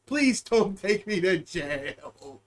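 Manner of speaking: slow, soft, pitiful begging; low slow plea to the judge; mock whimper. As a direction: whine it slowly like a scared kid.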